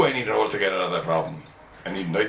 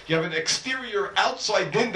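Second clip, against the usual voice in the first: slow, high-pitched emphasis on "exterior" and "outside"; stress on exterior; 8 semitones higher than usual. Shown as a demonstration. Teacher clarifying the key term, "exterior outside" emphasized. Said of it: "I'm stressing the word".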